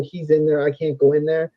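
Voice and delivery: ordinary delivery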